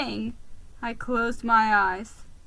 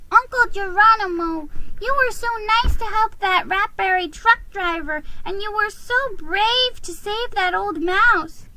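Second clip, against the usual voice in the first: put-on high, admiring young squeak; little nephew gushing over his uncle; high admiring child's voice; squeaky nephew; 10 semitones higher than usual. A narrator's reading. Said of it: "he squeaked".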